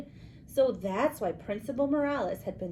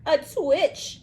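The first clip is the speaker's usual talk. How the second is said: loud, high witch punchline; 8 semitones higher, 8 dB louder than usual. A narrator's reading. Witch answering the skeleton's riddle, loud witch voice. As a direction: crow it out like a hag.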